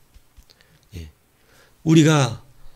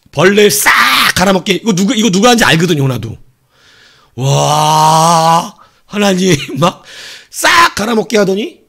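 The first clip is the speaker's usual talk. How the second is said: loud, higher, building agitation; worked up; 6 semitones higher, 7 dB louder than usual. Acted. Preacher relives Jonah's anger at God, loud and high. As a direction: pile on the outrage. Heated, excited retelling.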